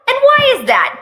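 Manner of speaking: suspicious mom. Acted. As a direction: pounce on the excuse like a mom. Mom interrogating son on phone.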